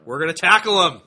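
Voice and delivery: building to a very loud, high battle cry; rallying yell. Real, not acted. Pumping up the listeners to tackle the films, loud battle cry. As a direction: whip the listeners up.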